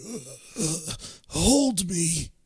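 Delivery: soft, breath-heavy ghost groan; ghostly moan. Retold or imitated. Ghost of a homeless man pleading with John, ghostly moan. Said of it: "a ghostly figure appears of the homeless man".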